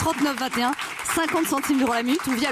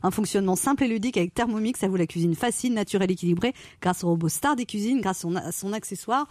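lower, steady advert reading; 4 semitones lower than usual. Acted. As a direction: read it like a radio ad. Reads the prize advert.

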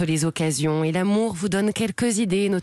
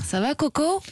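une imitation légère de son « Ça va, Coco? », plus aigu; imitant Fred Testo; 8 semitones higher than usual. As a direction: singer gentiment sa phrase fétiche, voix montée. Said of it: "Ce que vous dites". (petite imitation de Fred, plus haut)

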